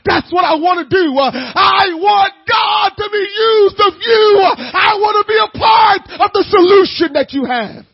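loud, high, shouting sermon; preaching at full force. Acted.

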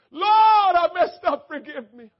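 high, loud crying plea; tearful confession. Shown as a demonstration. Sinner confessing to God, high crying plea. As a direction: break as you confess. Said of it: "Here's another response".

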